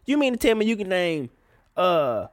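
normal talking voice

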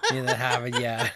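laughing, thick Boston "Hahvahd Yahd"; Boston accent. Pretend. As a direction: joke in a heavy Boston accent. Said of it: "stretch out our accents".

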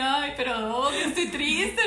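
high, loud fake-tearful whine; mock-sad whining. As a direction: mimic a drama queen, half laughing. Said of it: "que pues, ya me dicen".

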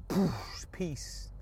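soft hushed whisper of calm. Acted. Whispering Neo's calm win over the bullets. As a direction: breathe the words out voicelessly. Acting out Neo in The Matrix.

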